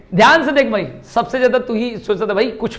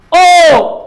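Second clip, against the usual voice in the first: ओ shouted long and high from the throat, lips rounded; loud ओ demo; 6 semitones higher, 7 dB louder than usual. Shown as a demonstration. Teacher shows students ओ with full force. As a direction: belt out ओ with a round mouth. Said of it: "आवाज भी निकलनी चाहिए पूरा जोर से".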